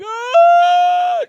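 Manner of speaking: long, very loud, high scared wail; mock crying wail. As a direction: wail like a frightened kid. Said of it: "just crying 'cause I was so fucking scared".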